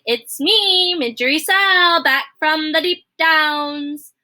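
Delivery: high, loud call across the kitchen; shouting Midge. A narrator's reading. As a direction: shout it out, slow on the last words. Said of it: "she shouted".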